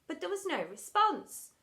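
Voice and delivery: usual speaking voice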